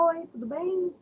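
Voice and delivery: soft, high, lilting hello; friendly greeting. Shown as a demonstration. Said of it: "porém com entonações diferentes".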